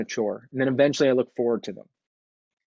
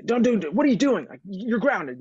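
put-on much higher, scolding the kid over the helmet; mock angry yell; 12 semitones higher than usual. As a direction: play the parent snapping at the skateboarder. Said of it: "you start yelling at them".

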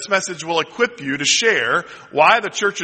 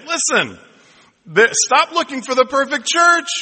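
emphatic admonishment; 8 semitones higher than usual. Retold or imitated. Acts out his reply to church shoppers.

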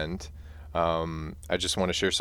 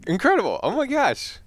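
much higher, louder burst of amazement; amazed; 4 dB louder than usual. Real, not acted. Listener overwhelmed by the tale, high excited exclaiming. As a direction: gush with real delight at the story. Reacting to moving story.

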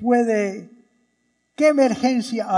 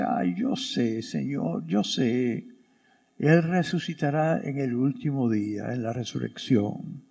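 lower, softer, sighing agreement; weary resignation; 8 semitones lower, 6 dB quieter than usual. Acted. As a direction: say it like someone worn out by grief. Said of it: "imagínate cómo Marta dijo eso".

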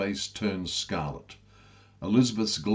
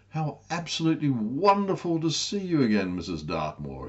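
high, gushing welcome that fades at the name; enthusing; 7 semitones higher than usual. A narrator's reading. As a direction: gush over her like an old friend. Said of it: "he enthused".

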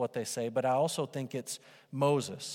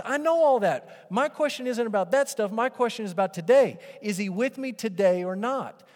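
higher, louder frustrated demand; exasperated doubter; 7 semitones higher, 7 dB louder than usual. Retold or imitated. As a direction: demand an answer now. Voicing the doubter at peak.

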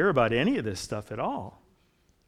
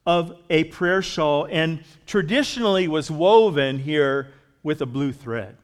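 ordinary talk, nothing marked

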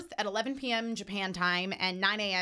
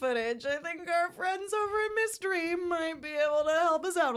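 high, slow giggly voice; laughing; 8 semitones higher than usual. Real, not acted. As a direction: talk through a sly laugh. Joking about piracy source.

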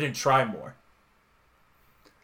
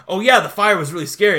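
mock boastful retelling, a bit louder; lightly acted brag, pitched up; bragging kid; 4 semitones higher, 6 dB louder than usual. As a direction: imitate a kid showing off. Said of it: "he's, like, bragging about".

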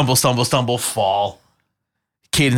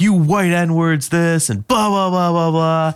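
imitating the drunk guys' taunt; a lightly voiced jeer, drawn out; mock drunk yelling; 6 semitones higher, slower than usual. Mocking the drunks yelling at them from across the street. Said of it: "they are just yelling".

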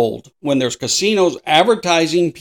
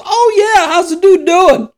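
eager mock question; 5 dB louder than usual. Old friend asks after someone.

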